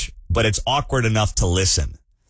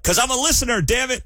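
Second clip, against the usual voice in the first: much higher, hammed-up indignant retort; mock indignant outburst; 13 semitones higher than usual. Dad snapping back at his daughter in mock outrage. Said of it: "I'm like".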